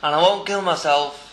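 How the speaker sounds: high, loud, drawn-out call; mimicked shouted demand. Escaped dealer bargaining from a pylon, acted loud high shout. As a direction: call it down from a great height. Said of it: "He said".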